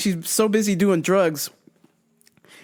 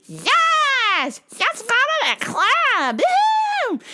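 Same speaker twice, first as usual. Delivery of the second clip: much higher, slow squealy party voice; mock party girl; slower than usual. The sister agreeing to go clubbing, squealing. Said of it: "she called her friend".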